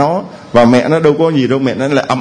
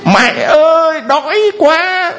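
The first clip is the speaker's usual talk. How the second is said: put-on much higher whiny child's wail; crying like a child; 13 semitones higher, slower than usual. Preacher playing a hungry child wailing to mother. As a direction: cry out to mommy like a hungry little kid. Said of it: "có lúc mình cũng khóc như đứa bé".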